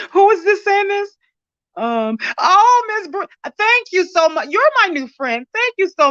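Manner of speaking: high, tearful thanks through sobs; real crying. Real, not acted. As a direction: thank them while the tears come. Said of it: "so I can try not to cry".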